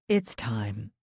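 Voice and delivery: plain reading voice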